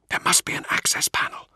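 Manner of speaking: tense unvoiced whisper; urgent whisper. Whispered planning.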